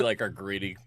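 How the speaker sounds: usual speaking voice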